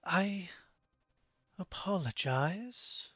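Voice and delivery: slow, soft, measured apology; hushed, deliberate formal voice; grave and drawn-out, very softly; apologetic woman leader. Acted. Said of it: "woman looks back up".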